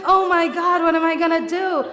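high, loud, laughing panic; hammed-up hysterical outburst; frantic rant.

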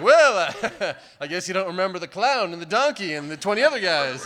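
joking levity. Retold or imitated. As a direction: try to break the tension with a goofy joke. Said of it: "bring a little levity".

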